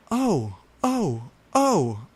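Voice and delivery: high, loud hammed-up moans; overacted cries of passion; mock scream.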